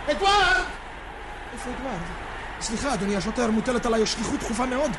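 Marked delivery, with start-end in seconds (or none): 0.1-0.6 high call across the street. Acted. Searching for Edward in the crowd, high call. Calling out for lost friend. 1.5-2.2 worried mutter. Acted. Worried wondering.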